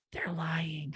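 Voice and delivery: a hushed, secretive whisper; whispered aside. Acted. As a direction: lean in and breathe it like a secret. Exposing the lying inner voices.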